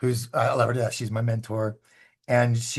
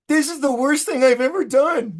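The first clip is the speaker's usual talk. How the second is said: much higher, louder self-mocking panic; panicked inner voice; 12 semitones higher, 5 dB louder than usual. Starstruck man panicking about his lie, higher and louder. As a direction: squeal it like you're in over your head. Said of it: "And I'm like".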